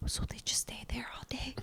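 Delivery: whispered aside, imitating a question; hushed question. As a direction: whisper it like a discreet question to mom. Retelling a hushed question to mom.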